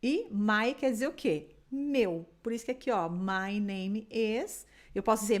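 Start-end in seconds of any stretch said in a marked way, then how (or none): 3.1-4.7 My name is drawn out very slowly; slow repeat model. Shown as a demonstration. Teacher leading class repetition, slow and clear. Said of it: "vamos repetir primeiro pra deixar essa pronúncia bem bonita".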